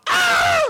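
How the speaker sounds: very high-pitched mock shriek; imitated scream. Husband imitating his wife in the car, mock shriek. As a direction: shriek as the panicked wife, played for laughs. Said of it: "why the wife was screaming".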